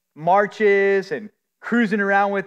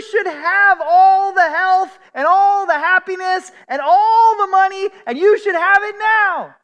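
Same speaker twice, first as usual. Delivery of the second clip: much higher, louder, sing-song mock sales pitch; mocking prosperity preacher; 12 semitones higher, 6 dB louder than usual. Preacher mocking churches that promise happiness, hammed-up sales pitch. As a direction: ham up a feel-good preacher's promises. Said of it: "a paradigm of".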